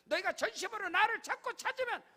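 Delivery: loud, high pulpit proclamation; full-force preaching. Acted. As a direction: decree it over the congregation. Preaching blessing and decree. (강단에서 외치는 설교)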